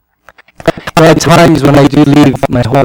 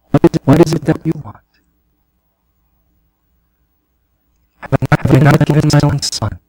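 tender voice of the Lord. Acted. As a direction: coax like a loving father. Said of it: "The Lord said".

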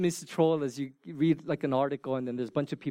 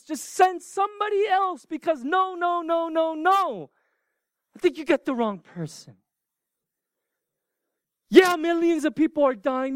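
higher, louder pleading Moses refusing the call; reluctant Moses; 10 semitones higher, 5 dB louder than usual. Acted. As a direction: beg to be let off, pushing back hard. Moses pleading not to be sent.

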